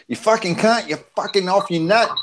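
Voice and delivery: high, cheeky Australian-style jab; mock Aussie insult. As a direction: fling it like a friendly Aussie insult. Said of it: "In the right manner".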